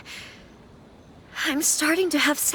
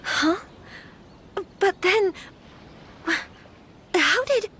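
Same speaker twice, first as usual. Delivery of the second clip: higher, broken-off stammer of confusion; bewildered; 6 semitones higher, slower than usual. Acted. Lady hearing the letter was penned by her hand, bewildered. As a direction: start to ask and falter, baffled. Told she supposedly wrote the letter.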